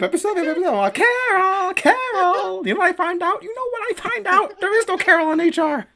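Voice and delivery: frantic high-pitched conspiracy rant; Charlie Day. Retold or imitated. Host re-enacts a meme rant about Pepe Sylvia, frantic high impression. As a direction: imitate a manic ranter, voice pushed way up. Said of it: "Charlie Day's rant about Pepe".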